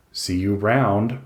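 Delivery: slow, ROUND drawn out and weighted; "See you ROUND", stretched as a hint; emphasis on ROUND. A narrator's reading. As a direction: lean pointedly on "round". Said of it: "I say it with a particular emphasis on the round".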